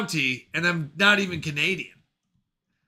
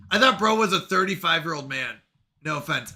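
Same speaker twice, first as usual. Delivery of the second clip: ordinary delivery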